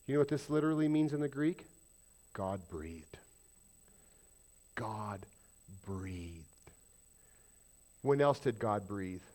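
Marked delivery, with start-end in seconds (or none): 2.3-3.2 low, soft, awed; hushed reverence. Real, not acted. Pastor telling the church what the Greek means, hushed. 4.7-6.4 low, soft, awed; hushed reverence. Real, not acted. Pastor telling the church what the Greek means, hushed.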